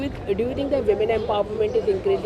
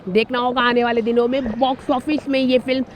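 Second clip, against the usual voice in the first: brisk, louder TV news voice-over; news report read; 3 semitones higher, 7 dB louder, much faster than usual. Acted. Reporter wrapping up the Mumbai story for viewers, fast louder news read.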